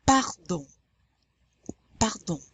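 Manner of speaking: French "Pardon" with a very light throat R; dry R. Shown as a demonstration. Teacher models twice for learners, French "Pardon". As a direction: barely breathe the R in "Pardon", nasal ending. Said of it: "That's also a dry R".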